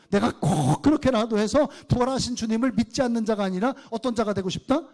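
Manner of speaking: ordinary talk, nothing marked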